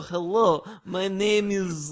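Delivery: hammed-up, drawn-out Russian accent; cheesy Russian accent. Teasing a co-host over his foreign accents, cheesy mock Russian. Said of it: "trying to do a foreign accent".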